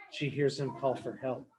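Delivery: usual speaking voice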